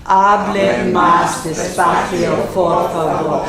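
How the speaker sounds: very slow, low, heavily drawn-out words; laborious. Shown as a demonstration. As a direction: plod through it word by word. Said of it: "this one you have to say laboriously".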